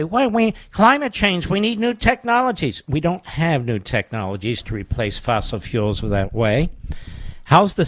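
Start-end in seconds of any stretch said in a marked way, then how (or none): 0.0-2.8 a lightly raised, higher mock-whiny teacher voice. Host mocking what the teachers say, in a higher mock-whiny voice. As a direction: parody their pleading, pitched up a bit. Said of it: "these teachers say".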